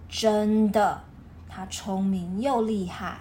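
normal talking voice

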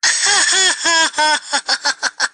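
high, scornful laugh; mocking laugh. Real, not acted. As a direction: laugh at her before the imitation. Mocking her before the quote.

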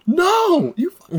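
high, loud hammed-up outburst; mock shocked cry. Imitating shocked nineties kids in the audience, high shriek. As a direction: shriek like a stunned fan.

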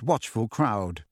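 ordinary delivery

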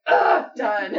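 loud fed-up groan, mocking; mock bored shout. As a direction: shout it like you're over it. Mocking a girl finishing, shouted.

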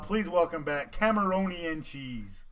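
ordinary delivery

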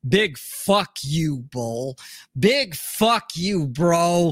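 slow, drawn-out mock insult; leisurely ribbing between friends; joking retort. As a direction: stretch it out, punching BIG. Voicing a jokey retort to friend.

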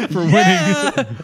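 laughing through the words; slow words broken by laughter. Real, not acted. Laughing while awarding prize.

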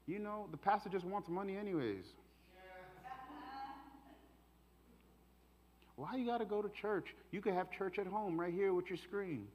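lightly voiced devil talking someone out of church; enemy's voice. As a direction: needle them softly with doubt. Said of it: "and this is how it sounds".

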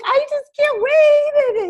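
high, loud, drawn-out gushing; super excited. Retold or imitated. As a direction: imitate mom bursting with excitement. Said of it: "super excited".

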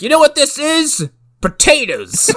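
high, loud showman reveal; proud mayor. Retold or imitated. The mayor showing off the conveyor belt, high and proud. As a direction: present it like a treasure. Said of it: "he's like".

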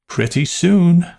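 high-pitched, absent-minded aunt; vague aunt. A narrator's reading. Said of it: "Aunt Amy said from the stove".

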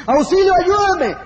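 high, loud, slowed cry of fear; terrified cry for help. Acted. Falling man cries out with all his soul, terrified. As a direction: yell it with your whole soul, afraid. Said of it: "gritar con toda su alma por el temor".

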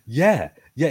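usual speaking voice

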